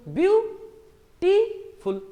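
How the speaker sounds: "ब्यूटीफुल" slow and high, ब्यू, टी, फुल; syllable by syllable. Shown as a demonstration. Teacher asking how many syllables, "ब्यूटीफुल" as ब्यू ... टी ... फुल. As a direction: separate "ब्यूटीफुल" into three beats with clear stops. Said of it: "कितने syllable हुए".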